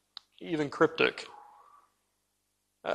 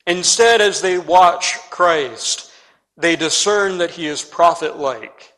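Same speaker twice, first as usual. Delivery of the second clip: preaching; 2 semitones higher, 6 dB louder, slower than usual. Acted.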